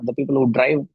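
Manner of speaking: ordinary talk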